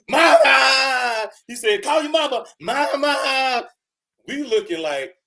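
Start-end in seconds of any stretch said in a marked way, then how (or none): 0.1-1.3 loud, higher crying shout for mama; crying scream. Retold or imitated. Private screams for his mama from the rooftop. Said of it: "so he could scream for his mama". 1.8-3.7 higher, mocking call; drill sergeant shout. Drill sergeant mocks the private, yelling mama.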